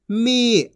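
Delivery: मी with a held ī; long drawn-out vowel. Shown as a demonstration. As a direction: stretch मी into a long "meee". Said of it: "खींच के पढ़ा जाता है".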